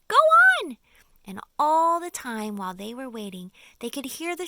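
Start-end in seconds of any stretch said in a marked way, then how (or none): 0.1-0.8 high, loud frantic cry; the whole group crying out for James to go on. Acted. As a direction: urge him on in desperation. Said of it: "they cried frantically".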